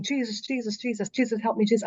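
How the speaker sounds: soft, hushed repeated calling on Jesus; a frightened half-whisper prayer for help; terrified plea. Retold or imitated. Hovering in space, calling on Jesus, softly terrified. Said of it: "I'm terrified".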